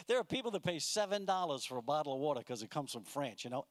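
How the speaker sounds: ordinary talk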